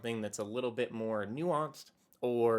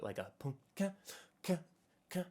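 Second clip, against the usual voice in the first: soft mouthed ska rhythm, pum ka ka ka; beatbox the ska upstrokes lightly; quiet vocal strum sounds; ska rhythm vocalized; 5 semitones higher, 7 dB quieter, faster than usual. Shown as a demonstration. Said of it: "that ska guitar that kind of adds this kind of bouncy feel".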